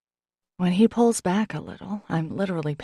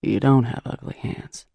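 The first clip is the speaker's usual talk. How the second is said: low, gently scolding man; a lightly voiced gruff man chiding; 6 semitones lower than usual. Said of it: "he chides".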